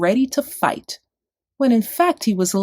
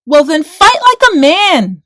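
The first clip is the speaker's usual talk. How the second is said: higher, loud challenge; rumbling challenge; 8 semitones higher, 10 dB louder than usual. A narrator's reading. David dares Goliath loudly. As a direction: hurl the dare at the giant. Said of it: "rumbled dinky small Dave".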